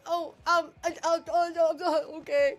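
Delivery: high, loud, panicky hesitation; mock-flustered string of stammers. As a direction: imitate yourself caving under pressure. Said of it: "I'm like".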